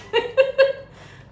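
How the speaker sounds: loud, high real laugh; high burst of laughter. Real, not acted. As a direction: let the laugh break out. Laughing at Edwina's reply.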